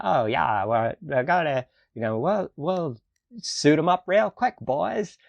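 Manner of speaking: imitating a mid-Atlantic accent, rising high; breezy old-Hollywood mid-Atlantic accent. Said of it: "mid-Atlantic accent or whatever".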